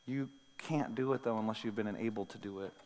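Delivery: ordinary talk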